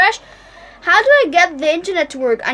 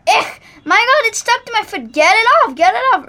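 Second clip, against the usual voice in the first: panicked shout; 6 semitones higher than usual. Acted. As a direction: shout it in disgust, twice. Trash stuck to his foot.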